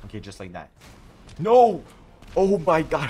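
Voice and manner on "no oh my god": loud, high shout of alarm; real panicked outcry; panicked yell. Real, not acted. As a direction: yell no as he gets hit. Enemy rushes him, yells.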